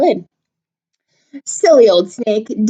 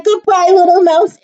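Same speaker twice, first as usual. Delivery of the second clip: panicked snake; 10 semitones higher, 5 dB louder than usual. Acted. Snake flees in fear.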